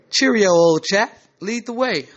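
high-pitched, put-on British accent. Emmanuel answering Mister Wilson, in a high British accent. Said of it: "in a British accent".

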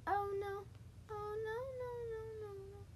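rising panic that fades away; panicked dread. Real, not acted. Dreading being caught.

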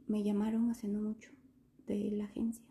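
tentatively confessing, fading out; hesitant hush. Retold or imitated. Telling mom the agency called, hesitant and hushed. Breaking news to mother.

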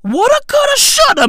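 "Woulda, coulda" high and loud, "shoulda" snapped down; mocking singsong. Shown as a demonstration.

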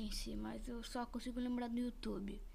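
soft unvoiced whisper. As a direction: murmur it as a secret. Whispered aside.

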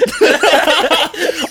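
short high laugh. Real, not acted. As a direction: keep laughing. Still laughing about YMCA.

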